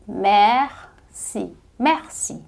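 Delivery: merci repeated with a falling tune; French merci demo. Shown as a demonstration. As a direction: say merci, then again softly. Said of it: "Só pronúncia que você conhece". (merci repetido para os alunos)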